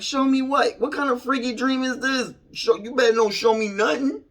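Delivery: loud, high-pitched mock outrage; high, loud and incredulous; alarmed protest. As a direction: replay a freaked-out objection, voice shooting up. Retold alarmed reply to Jesus.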